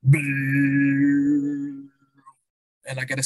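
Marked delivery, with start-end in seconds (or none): 0.1-1.9 long buzzing didgeridoo drone; imitated didgeridoo. Retelling his first go on the didge, imitated drone. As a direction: imitate a didgeridoo with a sustained brrr. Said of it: "So I get the didge and I'm like".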